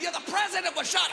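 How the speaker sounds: high excited yell; kid's excited yell. Retold or imitated. Boy repeating news from the hedge, high excited cry. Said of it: "He said".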